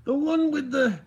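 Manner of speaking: high, loud put-on Scottish accent; hammed-up Scot, pitched well up; mock Scottish accent. Streamer describing a man with pipe, hammed-up mock Scottish accent.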